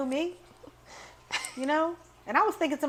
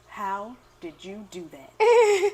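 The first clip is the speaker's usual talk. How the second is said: slow, higher, tearful amazement; amazed; 4 semitones higher, 8 dB louder, much slower than usual. Retold or imitated. Stunned mom asking how the baby fell asleep, slow and tearful. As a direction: drag it out in tearful disbelief. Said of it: "she looked at me like".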